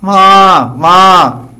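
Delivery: high, loud calf cry for its mother; calf bleating. Retold or imitated. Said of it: "the calf crying for the mother".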